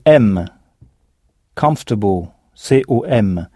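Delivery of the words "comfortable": "Comfortable," said KUMF-tuh-bl, stress on the first syllable; English pronunciation. Shown as a demonstration. Teacher shows the English word to French learners. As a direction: say "Comfortable," with the O and R nearly silent. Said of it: "la prononciation est très différente".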